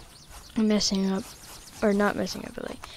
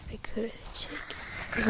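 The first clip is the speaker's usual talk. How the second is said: whispering; 12 dB quieter, slower than usual. Real, not acted. Trailing aside while playing.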